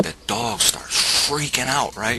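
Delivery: ordinary delivery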